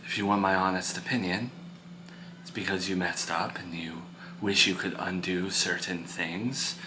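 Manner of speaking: low, hushed counsel to an upset son; calm, frank parent. Acted. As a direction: speak softly and low, measured and serious.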